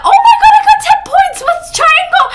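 very high, loud delighted squeal; shrieky burst of excitement; ecstatic squeal. Real, not acted. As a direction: squeal with joy at winning. Sees the points just won.

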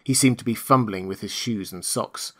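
ordinary delivery

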